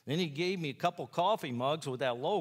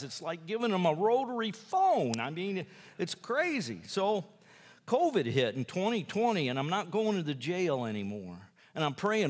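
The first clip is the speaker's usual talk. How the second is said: normal talking voice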